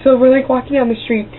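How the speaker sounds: normal talking voice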